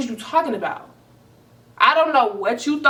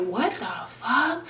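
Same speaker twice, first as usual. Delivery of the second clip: stunned disbelief; 3 semitones higher, 5 dB quieter, slower than usual. Retold or imitated. Reacting while scrolling his phone.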